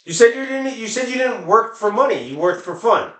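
usual speaking voice

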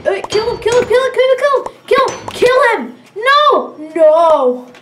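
high, loud, rising urgency; frenzied battle cry, ending in dismay; frantic shouting. Real, not acted. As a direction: yell repeated orders in a panic. Frantic fight in game.

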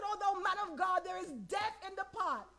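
high-pitched mock lament; acted high cry of alarm. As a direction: wail it without raising the volume. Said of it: "somebody cried out".

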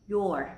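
"your" as one syllable, like the possessive; contracted you're. Shown as a demonstration. Teacher showing the contraction of you are, one-syllable "your". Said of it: "dạng rút gọn mình sẽ đọc là".

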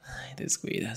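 usual speaking voice

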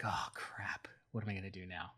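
low and soft, to himself; a hushed "oh no" under the breath; dismayed mutter. Acted. Zeus alone after losing the cow, muttering in dismay.

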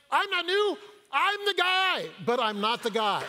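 high, loud indignant protest; playful high protest, breaking into a laugh; mock protest. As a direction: squeak it out, half-laughing at yourself. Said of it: "And I was like".

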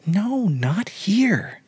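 high, very slow breathy whisper; hushed high girlish protest. Acted. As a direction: whisper a coy, drawn-out refusal. Girl refusing in hushed voice.

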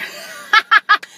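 high unvoiced giggle; a quick airy laugh; breathy laugh. Real, not acted. Laughs after holding back news.

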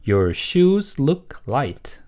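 slow, word-by-word model statement; model reading. Shown as a demonstration. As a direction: read slowly for learners to repeat. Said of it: "두 번". (천천히 또박또박 읽는 시범)

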